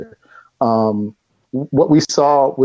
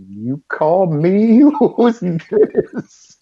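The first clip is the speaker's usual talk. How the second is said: disbelieving laugh; 7 semitones higher, much slower than usual. Retold or imitated. Own startled reply to caller.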